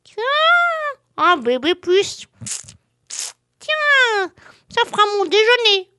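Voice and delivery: high, loud greedy snail; a hungry snail spotting lunch. Acted. Snail speaks.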